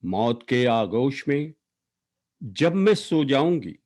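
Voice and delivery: emphatic, declaimed verse, line endings falling away; poetry recitation. Acted. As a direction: recite an elegy with weighted words and long pauses. Recites own poem for late sister.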